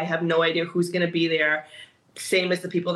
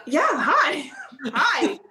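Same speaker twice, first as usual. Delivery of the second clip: excited greeting; 8 semitones higher, much slower than usual. Retold or imitated. Meeting a fan in lobby.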